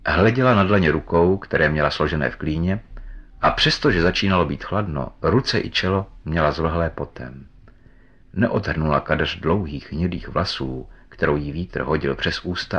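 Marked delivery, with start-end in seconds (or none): none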